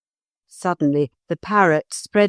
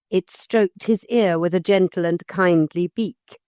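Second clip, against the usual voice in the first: usual speaking voice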